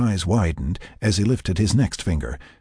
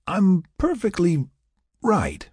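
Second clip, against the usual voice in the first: tipsy insisting; 12 semitones higher, slower than usual. A narrator's reading. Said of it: "she insisted".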